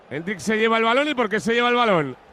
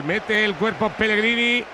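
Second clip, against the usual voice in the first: ordinary delivery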